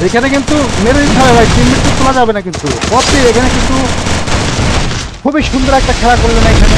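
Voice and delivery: excited caster. Real, not acted. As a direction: call the fight with rising hype. Caster calls a tense fight.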